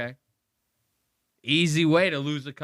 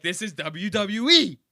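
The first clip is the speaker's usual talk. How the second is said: higher, louder mock wrestling announcer shout; 8 semitones higher, 4 dB louder than usual. Host hams up wrestling hype to his crew, mock announcer shout. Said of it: "I'd be like".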